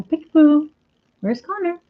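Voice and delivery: high playful peekaboo voice; peekaboo baby talk. Retold or imitated. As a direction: coo it high like playing with a toddler. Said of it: "you go".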